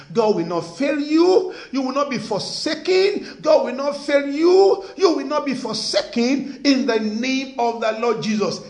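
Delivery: fervent pulpit proclamation; preaching blessing. Acted. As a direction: proclaim it over them with force. Preaching blessing over congregation.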